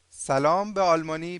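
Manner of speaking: ordinary delivery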